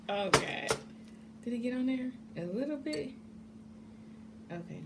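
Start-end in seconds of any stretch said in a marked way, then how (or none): none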